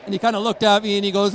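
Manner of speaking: ordinary talk, nothing marked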